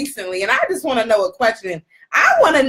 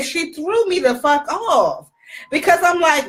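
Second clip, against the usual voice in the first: normal talking voice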